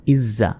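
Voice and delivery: ইজ জা as iz-za, buzzing z held; doubled consonant. Shown as a demonstration. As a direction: hold the z of ইজ into জা. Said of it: "দুইবার উচ্চারণ হবে". (ইজ জা, জ দুইবার)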